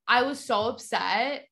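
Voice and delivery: ordinary delivery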